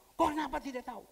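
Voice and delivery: loud, high indignant question; loud incredulous scolding; angry retort. Retold or imitated. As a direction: snap back in disbelief.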